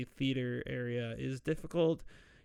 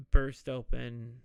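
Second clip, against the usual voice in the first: normal talking voice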